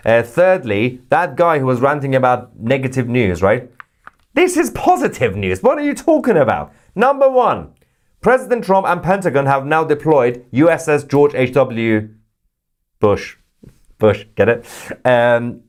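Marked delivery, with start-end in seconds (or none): 4.3-10.7 high, fast, incredulous outburst; exasperated excitement. Real, not acted. Host answering the ranting viewer, high and incredulous. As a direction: throw it back at the critic, wide-eyed. Mock-exasperated reply to critic.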